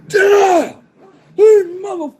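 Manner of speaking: high, loud roaring shout breaking into a laugh; furious yell. Retold or imitated. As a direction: scream it like a raging giant. Big Mike going off.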